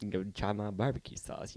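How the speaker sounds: softly murmured impression of someone talking. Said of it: "he was like".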